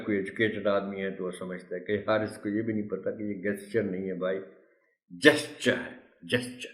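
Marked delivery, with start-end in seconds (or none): 5.1-6.7 جسچر with the weight on جس; stress on first syllable. Shown as a demonstration. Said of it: "جی پر stress ہے سارا". (جسچر، پہلے حصے پر زور)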